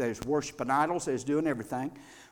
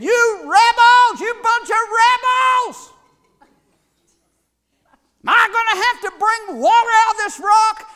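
furious shout; 15 dB louder than usual. Acted. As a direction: roar at the rebels in rage. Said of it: "The meekest man in all the earth got mad".

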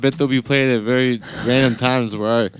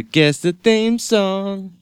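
drawn out; 8 semitones higher, much slower than usual. Pretend. Dragged out slow guess.

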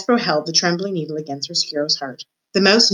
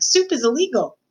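high-pitched protest, volume held back; mild shout; 7 semitones higher than usual. A narrator's reading. Mig objecting to the soup, high protest. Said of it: "shouted Mig".

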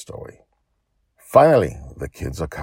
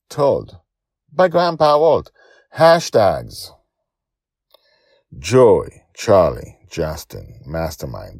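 loud, lifted list reading; closing credits read; 3 semitones higher, 10 dB louder than usual. A narrator's reading. Storyteller closing the episode for listeners, loud list. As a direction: read out the credits like a show outro.

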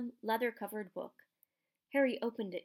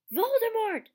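loud, high-pitched shout; bellow; 14 semitones higher, 11 dB louder than usual. A narrator's reading. Harry shocking Hagrid out of crying, bellowing. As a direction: bellow the name to shock him. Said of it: "Harry bellowed".

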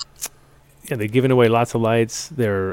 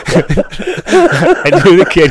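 slow, laughing words; laughing hard through it; 5 semitones higher, 8 dB louder, much slower than usual. Real, not acted. Host laughing at guest.

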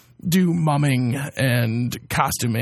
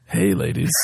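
low husky smoker's rasp; 10 semitones lower than usual. Real, not acted. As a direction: greet them in a deep rasp. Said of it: "Who is this husky voiced gentleman?".

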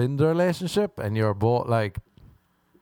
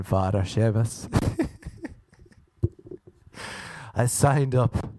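usual speaking voice

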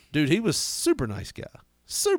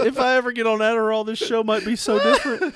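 higher pitched talk through real laughter; laughing hard; 7 semitones higher, 4 dB louder than usual. Real, not acted. Host joking to co-host about his ADD, laughing. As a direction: speak while still cracking up. Laughing fit over own tangent.

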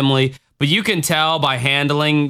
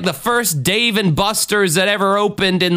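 ordinary delivery

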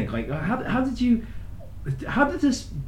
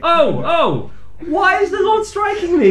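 high, wailing mock plea under attack; mock distress; 12 semitones higher than usual. Friend teasing the Lord's smack, mock wailing. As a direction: cry out as if struck down by God.

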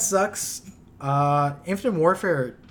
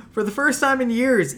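news read; 11 semitones higher, 10 dB louder than usual. Acted. Reads news item aloud.

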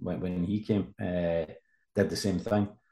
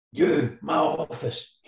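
higher, slow barked order from the boss; angry order; 6 semitones higher, much slower than usual. Retold or imitated. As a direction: slowly spell out the summons to the office. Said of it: "he was shouting in my face".

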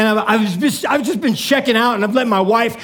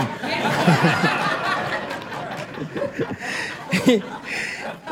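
long breathy laugh; 4 semitones lower, 8 dB quieter than usual. Real, not acted. Big laugh after punchline.

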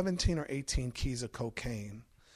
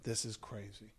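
much softer, lower dazed mumble; walking back stunned, much softer; muttering despair; 5 semitones lower, 13 dB quieter than usual. Retold or imitated. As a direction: mutter it under your breath in shock. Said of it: "I'm like".

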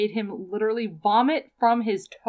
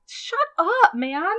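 outraged shriek; 6 semitones higher, 4 dB louder, slower than usual. Real, not acted. Outraged at the book passage.

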